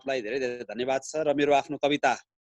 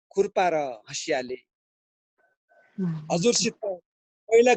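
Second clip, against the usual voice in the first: higher, louder than chat, in phrases with pauses; poem recital; 6 semitones higher, 6 dB louder than usual. Acted. Villager Kale addresses a forgetful leader, reciting a poem. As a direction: recite verse with swelling phrases, dropping on the refrains.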